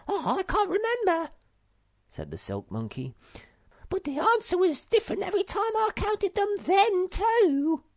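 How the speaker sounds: a silly monkey who can't count; Silk Monkey voice. A narrator's reading.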